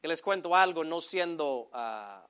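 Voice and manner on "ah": ordinary delivery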